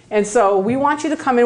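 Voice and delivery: normal talking voice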